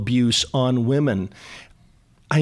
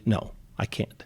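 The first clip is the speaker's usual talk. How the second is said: hushed refusal; 4 semitones lower, 8 dB quieter than usual. Acted. Voicing a threatened husband's refusal.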